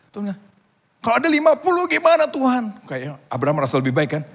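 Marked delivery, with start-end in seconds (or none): 1.0-2.7 high, loud bargaining question; pleading questioner. Acted. Abraham bargains with God, high pleading question. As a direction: ask pitched up, pressing for an answer.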